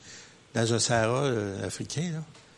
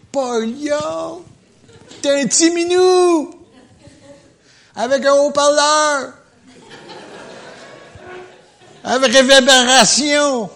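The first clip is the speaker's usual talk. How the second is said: much higher, mock squeaky kitten voice; mocking tiny kitten; 11 semitones higher, 6 dB louder than usual. Preacher mocks the devil as a kitten with loudspeaker, squeaky. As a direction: ham up a little kitty with a loudspeaker.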